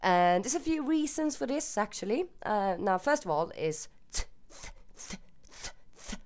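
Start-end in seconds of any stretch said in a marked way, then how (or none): 4.1-6.2 "th" as a soft, voiceless hiss of air; isolated th sound. Shown as a demonstration. Swede showing a sound Swedish lacks, whispery voiceless "th". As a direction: repeat a bare "th", tongue on teeth, no voice. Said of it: "that sound".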